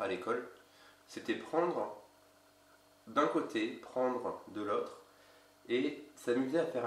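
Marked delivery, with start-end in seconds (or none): none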